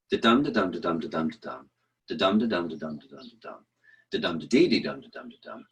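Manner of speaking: da-dum beat broken by da-dee-dee-dum; steady beat, then trip into da-dee-dee-dum; broken iambic rhythm. Shown as a demonstration. Teacher showing Shakespeare breaking the regular rhythm. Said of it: "a speech of his might go".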